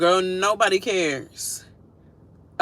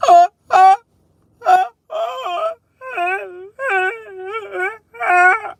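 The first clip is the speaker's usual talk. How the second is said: high hammed-up wailing sobs; mock sobbing; 7 dB louder, much slower than usual. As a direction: imitate a grown man crying like a child. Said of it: "Hope just like".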